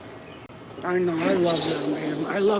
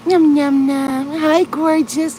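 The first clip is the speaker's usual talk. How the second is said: high loud nom nom; playful high pet voice; 7 semitones higher, 6 dB louder, slower than usual. Real, not acted. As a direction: chant nom nom to the kittens. Cooing at eating cats.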